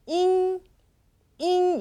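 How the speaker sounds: 잉 held out as an isolated ending; pronunciation demo. Shown as a demonstration. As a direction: model 잉 slowly for students. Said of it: "이렇게 끝나게 됩니다".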